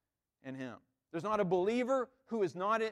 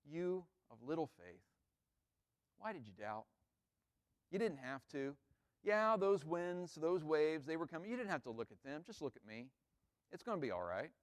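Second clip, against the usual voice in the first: much softer and lower, tender reassurance; Jesus gently reassuring a sinking Peter; gentle, not stern; 3 semitones lower, 7 dB quieter than usual. Pretend. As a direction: lift him up kindly as you pull him out. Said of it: "It's not stern".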